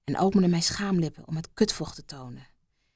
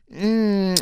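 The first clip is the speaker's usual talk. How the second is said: langgerekt gespeeld 'mm'; genietend gekreun. Acted. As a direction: kreun met gesloten mond. Genietend geluid tijdens verhaal. (aangehouden genietende zucht)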